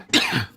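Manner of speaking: real tickly cough; a sudden rough cough; chesty cough. Real, not acted. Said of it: "Tickly cough again going on".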